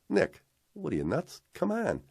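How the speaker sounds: high-pitched, playful disbelief; imitated "are you crazy" insistence; incredulous urging. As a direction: coax a hesitant friend along, acting him out. Retold friend urges him along.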